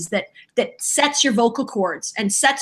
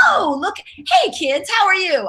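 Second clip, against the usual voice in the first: high, drawn-out character greeting; wrong warm-up line; 8 semitones higher than usual. Shown as a demonstration. Voice actor trying another line to show it fails, high character voice. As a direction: call to the kids in a high cartoon voice. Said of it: "if I sort of went".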